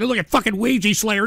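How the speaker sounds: ordinary talk, nothing marked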